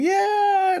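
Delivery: very high, gleeful cheer; mock dog excitement. Owner voicing dog racing into snow, high glee. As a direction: squeal it like an overexcited dog.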